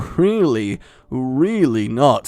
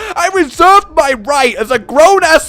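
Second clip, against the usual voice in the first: much higher, louder mock-angry rant; 10 semitones higher, 7 dB louder than usual. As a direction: rant in fake outrage at bald people. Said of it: "I just got angry at bald people".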